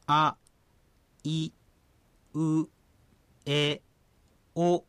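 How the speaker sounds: あ、い、う、え、お as clear single sounds; teacher's vowel model. Shown as a demonstration. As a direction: model あ、い、う、え、お for the student to repeat. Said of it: "私が言った後に". (手本としてあ、い、う、え、お)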